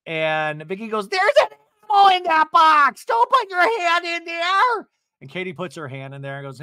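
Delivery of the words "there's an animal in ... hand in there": very high, loud shrieked warning; hammed-up alarm, stretching the end; mock panicked scream. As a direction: imitate a hysterical reality star. Acting Vicky's panicked warning.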